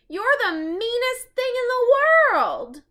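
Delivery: high, loud furious yell; shouted. Acted. Annie scolding the monkey from the canoe, high loud yell. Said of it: "Annie shouted".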